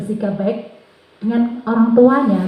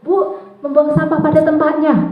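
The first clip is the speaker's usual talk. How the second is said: lightly voiced pupil, higher pitch; anak menjawab guru; 6 semitones higher, 3 dB louder than usual. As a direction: imitate a child offering an answer. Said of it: "Ada juga". (suara murid menjawab, agak tinggi)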